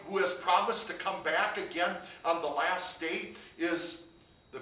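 loud, rising sermon build, slowing on the key words; full-force preaching climax; fervent preaching. Acted. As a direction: drive it home from the pulpit.

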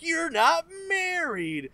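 high, drawn-out mock accusation; mock villain accusation. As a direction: stretch it out high like a gotcha reveal. Acts out villain exposing the henchman.

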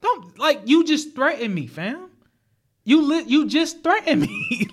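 high, disbelieving what, rising; stunned you just threatened me; incredulous outrage. Retold or imitated. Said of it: "So I'm like".

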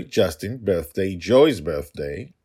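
plain reading voice

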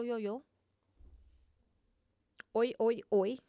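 ordinary talk

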